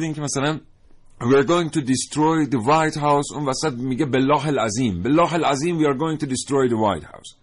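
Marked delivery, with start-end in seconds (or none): 1.2-3.3 very slow, higher and louder, with a hint of an Arabic accent; mock an extremist's slow English threat with an Arabic accent; Arabic-accented English, drawn out like a spokesman's threat. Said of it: "ته لهجهٔ عربی". 4.1-7.4 put-on higher and louder, with an Arabic oath and an Arabic-accented English threat; mock the spokesman who swears in Arabic mid-threat; slow Arabic-accented threat with an Arabic oath spliced in; Arabic oath mixed into English. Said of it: "این لغات رو در کنار اون یکی لغات انگلیسی بشنوید".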